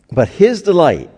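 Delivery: "his" lifted high and loud, falling off after; emphatic quote. Shown as a demonstration. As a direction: ring out "But his delight" like a proclamation. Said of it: "it's even an emphasis".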